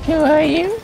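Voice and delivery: high, loud parroting; mocking mimicry. Mocking a friend who asked who they are, high-pitched mimicry. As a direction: echo the question in a silly high voice.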